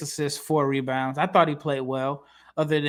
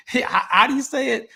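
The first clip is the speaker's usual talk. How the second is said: higher-pitched retold bafflement, through laughter; puzzled laughing question; 9 semitones higher, 5 dB louder than usual. Host retelling his question to Lithuanian scout friend, amused bafflement. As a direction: ask it again, tickled and lost.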